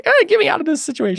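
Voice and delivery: high, hurried creature squeak; high-pitched plea to escape; panicked little creature. Acted. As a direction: blurt it out high and fast, wanting out. Voicing the scared creature's reaction.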